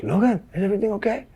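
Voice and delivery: put-on soft, high Swedish accent; hushed check-in with a Swedish accent. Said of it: "the Swedish kid".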